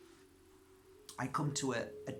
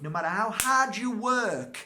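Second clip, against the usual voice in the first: higher and louder, leaning on hard; loud emphatic; 9 semitones higher, 10 dB louder than usual. Real, not acted. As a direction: drive the point home. Preaching rising point.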